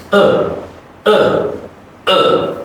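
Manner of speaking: "uh" very short and weak, mouth relaxed; tiny slack "uh" sounds; barely voiced "uh"; short weak schwa. Shown as a demonstration. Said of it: "It's very short, very weak".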